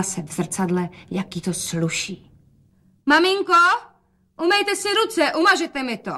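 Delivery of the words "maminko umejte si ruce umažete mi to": much higher, louder, cheerful call; bright calling out. A narrator's reading. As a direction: call out loud for father to hear. Dcera hraje veselost pro otce. (hlasité veselé volání)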